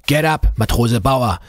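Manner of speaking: loud gruff chief, pitched high; rough, urgent call to a sailor. A narrator's reading. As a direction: call out like a gruff old petty officer. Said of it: "a gruff voice called from the dark".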